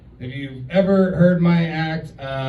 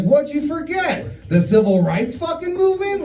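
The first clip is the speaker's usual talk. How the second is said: sarcastic retort; 11 semitones higher than usual. Retold or imitated. Retorting to grandparents.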